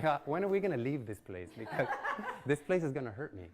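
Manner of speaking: begging to leave, amused; pleading through laughter; laughing plea. Retold or imitated.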